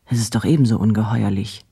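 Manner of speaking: usual speaking voice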